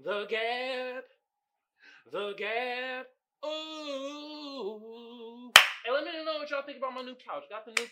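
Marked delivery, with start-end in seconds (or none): 0.0-1.0 high, softer "The Gab"; soft, high title call; changed-up show name. Shown as a demonstration. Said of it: "let me change it up for y'all". 2.1-3.1 high, softer "The Gab"; soft, high title call; changed-up show name. Shown as a demonstration. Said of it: "let me change it up for y'all". 3.4-5.5 long, high, quiet ooh; playful drawn-out ooh; high soft "ooh". Real, not acted. Playful vocal flourish after show name.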